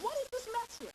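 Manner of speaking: high-pitched mock parental yell. Shown as a demonstration. Parent yelling at children about mess, mock yell. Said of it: "If you are yelling at your children".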